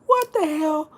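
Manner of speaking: very high-pitched mock panic; freaked-out reaction. Retelling your reaction to scares in line, high mock-freakout.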